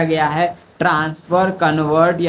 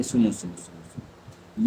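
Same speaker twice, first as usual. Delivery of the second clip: hushed calming; 7 semitones lower, 7 dB quieter, slower than usual. Real, not acted. Teacher calming a student.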